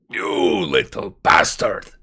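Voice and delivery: yelled curse. Retold or imitated. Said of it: "you hear Carl yelling".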